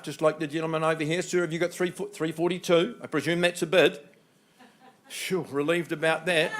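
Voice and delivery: ordinary talk, nothing marked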